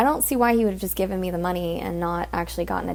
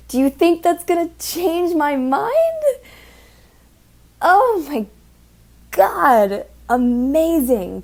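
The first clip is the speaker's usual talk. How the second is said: higher, louder, drawn-out disbelief; exasperated disbelief; 7 semitones higher, 5 dB louder, slower than usual. Real, not acted. Stripper venting about a customer, loud exasperated disbelief. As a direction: marvel at how dense he is.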